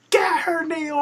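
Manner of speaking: high-pitched egging on; retold fan cheer. Host reliving her cheer for Naomi, excited yell. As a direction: urge the wrestler on from the crowd. Retelling own cheering at match.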